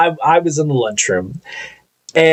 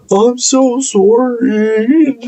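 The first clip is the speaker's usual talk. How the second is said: imitated sob, higher and very slow; mock crying; 8 semitones higher, much slower than usual. Supervisor still crying to coworker, mock tearful apology. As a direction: stretch the apology out in a fake weep. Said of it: "Dude, stop crying".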